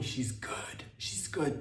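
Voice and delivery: hushed she's good; whisper. Retold or imitated. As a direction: whisper it reassuringly. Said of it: "reassuring whisper".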